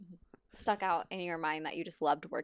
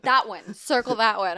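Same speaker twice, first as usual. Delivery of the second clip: loud, higher, emphatic call; vocal, decisive; 4 semitones higher, 9 dB louder than usual. Retold or imitated. Director telling the script supervisor which take, loud and decisive. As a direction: call it out with no doubt, imitating a director. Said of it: "they're vocal with me".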